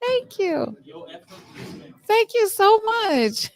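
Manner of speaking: hammed-up teary fan thanks; mock gushing. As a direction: swoon over the thanks. Fangirl gushing.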